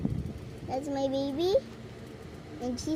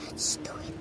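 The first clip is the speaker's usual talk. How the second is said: whisper; 6 dB quieter, faster than usual. Real, not acted. Whispered to baby.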